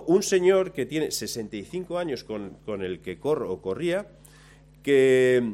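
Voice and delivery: normal talking voice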